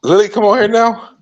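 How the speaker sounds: high, loud call to the dog. Real, not acted. Calling the dog over.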